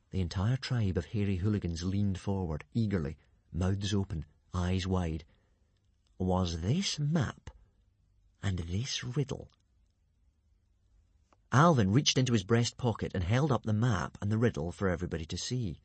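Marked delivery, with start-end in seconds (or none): 6.2-9.5 slow, drawn-out reveal; dramatic reveal. Acted. Alvin teasing the eager tribe, slow reveal. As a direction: let each word hang.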